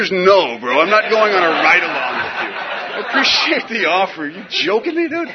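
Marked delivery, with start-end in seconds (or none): none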